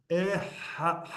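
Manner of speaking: "eha-" broken off on a throaty het. Shown as a demonstration. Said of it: "there's throat in there".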